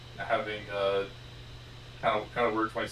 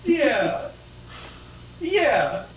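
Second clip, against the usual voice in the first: loud, very high-pitched and falling; high, loud falling whine; high drawn-out yeah; 6 dB louder than usual. Pretend. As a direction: squeal out a mock-agreeing yeah. Put-on high reply in banter.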